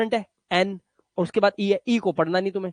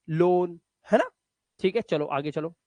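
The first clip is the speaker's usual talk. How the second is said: ordinary talk, nothing marked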